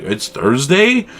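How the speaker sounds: a replayed double take, lifted high; mock surprise. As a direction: play your startled self from the retelling. Retelling his own surprise.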